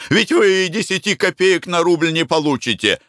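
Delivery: high, agitated objection; heated. A narrator's reading. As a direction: get worked up, pitch jumping high. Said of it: "горячился Марко Данилыч". (горячо, возбуждённо)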